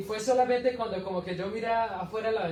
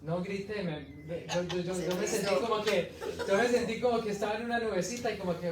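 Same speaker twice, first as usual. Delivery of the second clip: normal talking voice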